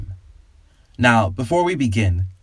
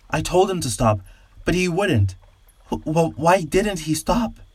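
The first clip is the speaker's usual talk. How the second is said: a man stuttering in fear, pitched higher; frightened stammer; 7 semitones higher than usual. A narrator's reading. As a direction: stammer it out, terrified. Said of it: "shaking in fear".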